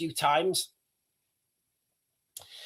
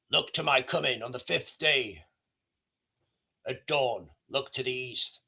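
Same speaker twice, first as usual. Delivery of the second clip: godly Gandalf voice; 6 semitones lower, 7 dB quieter than usual. Retold or imitated. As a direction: speak like a distant godlike wizard. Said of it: "as like an overarching godly figure".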